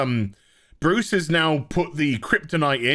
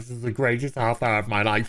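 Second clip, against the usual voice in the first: mocking gushing fan; 7 semitones lower, faster than usual. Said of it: "Some people are like".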